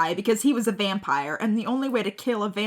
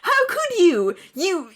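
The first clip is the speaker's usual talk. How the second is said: mock shout; 8 semitones higher than usual. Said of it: "he shouted".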